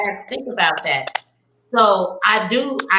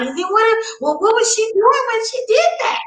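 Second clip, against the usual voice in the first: much higher, disbelieving questioning; incredulous mom. Retold or imitated. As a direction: grill the kids in a high incredulous voice. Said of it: "then I'm like".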